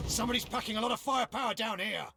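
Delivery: high, fast, loud battle yell; urgent warning yelled in a firefight; alarmed shout. Acted. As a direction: shout it over the gunfire. Calling out under heavy fire.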